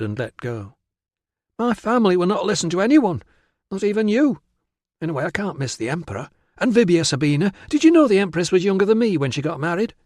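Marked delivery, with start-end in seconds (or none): 1.6-9.9 put-on high, emphatic girlish objection turning to quick chatter; protesting, excitable girl. Virana dissuades Tilla from visiting her family, excitable girl. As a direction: object fiercely, then gush, pitched up.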